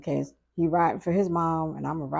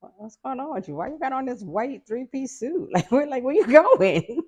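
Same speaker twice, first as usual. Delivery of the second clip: high, laughing mock disbelief, slowing at the end; teasing laughter; 8 semitones higher, 6 dB louder than usual. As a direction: tease the suit while cracking up. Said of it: "And I was like".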